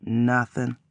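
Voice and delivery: soft low mutter. A narrator's reading. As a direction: mumble it away. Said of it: "I muttered".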